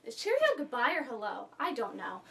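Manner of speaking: dropped to a soft, low aside; quiet aside. Real, not acted. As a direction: wonder aloud under your breath. Doubtful aside after the accent bit.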